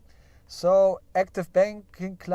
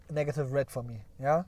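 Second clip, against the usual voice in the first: lowered, softer voice, jokingly downcast; mock tearful; 3 semitones lower, 4 dB quieter than usual. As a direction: let the voice sink in mock disappointment. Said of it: "You see my voice gets lower".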